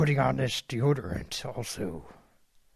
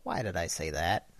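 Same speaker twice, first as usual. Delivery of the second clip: imagined regretful thought; 7 semitones lower, 7 dB quieter than usual. Retold or imitated. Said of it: "listen back to this and think".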